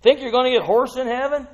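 usual speaking voice

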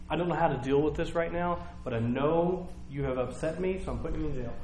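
very soft, held-back voice; restrained displeasure. Acted. Council deciding to jail Peter and John, quietly displeased.

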